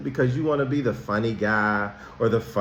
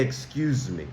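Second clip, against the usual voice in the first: much softer and lower, gentle excuse me; polite kind voice; 7 semitones lower, 8 dB quieter than usual. Shown as a demonstration. Teacher showing students how to politely ask to pass, soft and kind. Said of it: "say it in a polite, kind voice".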